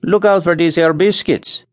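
worried Scandinavian settler; anxious Scandinavian accent. A narrator's reading. Nels warns Charlie anxiously in a Scandinavian accent. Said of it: "exclaimed his partner anxiously".

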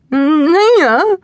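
put-on high, loud dopey alien girl. A narrator's reading. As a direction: blurt it like a starstruck alien. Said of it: "taken over by Nuala, the alien girl".

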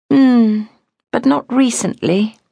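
high, thoughtful hum then reply; musing. A narrator's reading.

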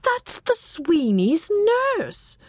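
slow, high-pitched astonished recognition; astonished cry. A narrator's reading. Wife recognising the neighbours' nurse, astonished cry. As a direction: stretch out the startled discovery. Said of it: "Irene cried".

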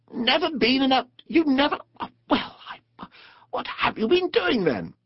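high, halting disbelief fading to speechless; splutter, lose the words, then demand; astonished spluttering. A narrator's reading. Rat hears Mole has never boated, flabbergasted. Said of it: "open-mouthed".

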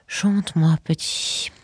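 put-on thick, slurred drunken mumble; drunk, words pasted together, fading out. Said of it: "d'une voix pâteuse".

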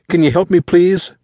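high, loud, urgent request; high-pitched, anxious plea; pleading boy. A narrator's reading. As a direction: beg for help. Frantic boy begs for help.

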